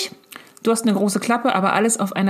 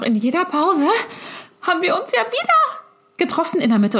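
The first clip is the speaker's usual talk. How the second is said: much higher, bubbling with excitement; excited recollection; 10 semitones higher than usual. Retold or imitated. Mother excitedly recalls meeting her friend backstage. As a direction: lift it up like a giddy fan.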